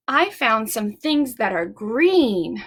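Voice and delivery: slow, deliberate show-and-tell; slow teacher reveal. Shown as a demonstration. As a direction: draw it out for the kids.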